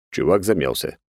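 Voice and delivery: usual speaking voice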